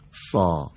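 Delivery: سا with a full, heavy, back-of-mouth s and a deep aa; thick heavy sa. Shown as a demonstration. Teacher demonstrating heavy سا to a student. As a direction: say سا thick, like a heavy Arabic sad. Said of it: "مثل سین فارسی اما درشتر".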